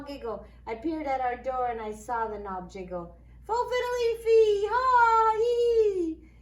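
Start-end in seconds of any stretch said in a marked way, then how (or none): 3.4-6.3 high, loud cackling leprechaun cry; gleeful leprechaun. Acted. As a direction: crow with triumphant glee.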